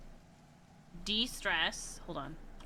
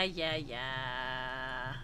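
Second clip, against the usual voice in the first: lower, drawn-out "yeah, yeah" in self-mockery; low mock dismissal; 8 semitones lower, 3 dB quieter than usual. Real, not acted. Streamer scolding herself after a wrong pick, low and sulky. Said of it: "I'm a failure".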